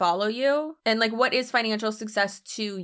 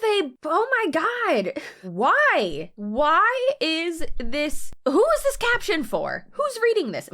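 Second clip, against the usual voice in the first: high, drawn-out exasperated whine; 7 semitones higher, slower than usual. Real, not acted. Reacting to a long caption, exasperated high whine. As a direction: groan at the endless caption, pitch climbing.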